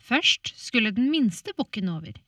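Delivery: fairy tale narrator voice; storytelling. A narrator's reading. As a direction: tell it like a bedtime tale.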